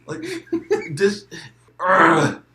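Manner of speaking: laughing. Real, not acted. Cracking up at old man voice.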